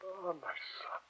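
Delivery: unvoiced grieving whisper; hushed heartbroken pity. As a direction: sigh it out in sorrow. Professor grieves for student.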